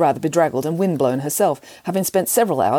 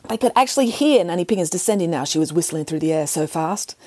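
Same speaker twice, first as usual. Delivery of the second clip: ordinary delivery, nothing marked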